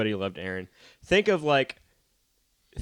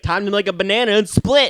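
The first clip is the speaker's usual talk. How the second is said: high, loud cartoon one-liner; mock catchphrase; 9 semitones higher, 10 dB louder than usual. Slippery kid escapes the grab, high loud catchphrase. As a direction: toss out a cheesy escape line. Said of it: "did he say, like, catchy phrases".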